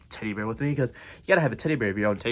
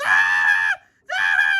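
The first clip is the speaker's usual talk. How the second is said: loud double shriek; frightened screaming; 7 semitones lower, 6 dB louder than usual. Acted. Frightened on the ride.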